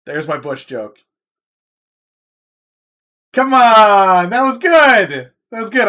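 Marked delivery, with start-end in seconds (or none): 3.3-5.9 much higher and louder, pleading with chat; insisting it was good. Real, not acted. Streamer defending his Bush joke to chat, loud and high. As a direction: push hard that the joke landed.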